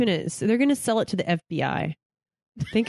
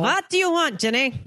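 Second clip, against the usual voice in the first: much higher mock Italian mom voice; 6 semitones higher than usual. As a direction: nag like an Italian mother. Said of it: "Italian mom".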